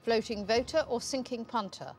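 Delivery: ordinary delivery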